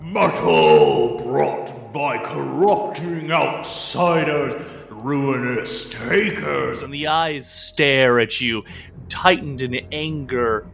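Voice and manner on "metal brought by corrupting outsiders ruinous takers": a hint of a slow booming giant; lightly booming ancient tree. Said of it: "the tree ant booms".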